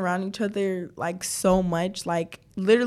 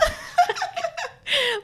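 high-pitched burst of laughing; real laughter. Real, not acted. Friend cracking up over their dumb antics, high laughter. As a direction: crack up helplessly.

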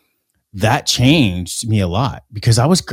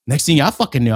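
ordinary talk

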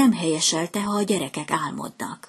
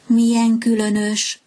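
louder, higher cry of surprise; surprised exclamation; 5 semitones higher, 4 dB louder than usual. A narrator's reading. Swallow feels rain under a clear sky, surprised and louder. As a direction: exclaim in wonder. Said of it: "kiáltott fel".

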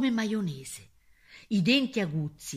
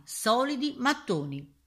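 ordinary delivery, nothing marked

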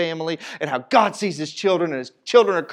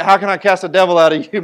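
mock fierce prayer warrior; 8 dB louder than usual. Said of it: "looked like, more like they were like".

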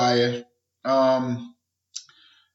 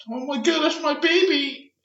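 high-pitched mock parental wail; put-on high voice of anguish; distraught parent; 11 semitones higher than usual. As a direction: imitate a parent recognizing their child. Grieving parent voiced in a story.

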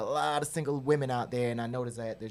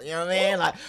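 excited; 6 semitones higher, 13 dB louder, much faster than usual. Real, not acted. Boasting sugar daddy line.

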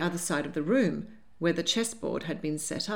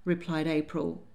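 usual speaking voice

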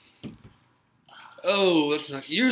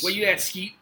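mock taunt; 4 semitones higher than usual. Mocking [name]'s taunt.

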